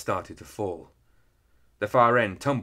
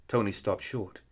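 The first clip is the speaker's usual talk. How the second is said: usual speaking voice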